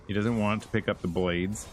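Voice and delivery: usual speaking voice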